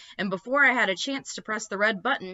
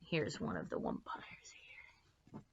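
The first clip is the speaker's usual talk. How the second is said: hushed aside, almost no voice; whisper; 12 semitones lower, 15 dB quieter than usual. Real, not acted. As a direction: lean in and whisper it. Showing a picture, whispered aside.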